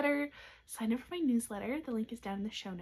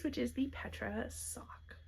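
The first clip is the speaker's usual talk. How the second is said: ordinary delivery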